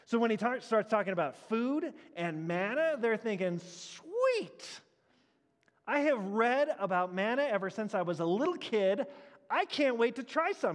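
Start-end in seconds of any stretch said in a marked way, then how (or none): 3.6-10.8 eager, higher mock excitement of the crowd; excited crowd. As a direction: play the hungry crowd thrilled at free manna. Crowd imagined craving manna.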